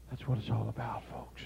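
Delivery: low, hushed whisper; soft breathy aside to the congregation. Acted. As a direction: drop to a whisper to land it. Quiet close of the story.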